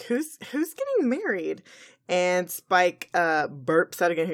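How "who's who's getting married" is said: confused surprise. Acted. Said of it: "She's like".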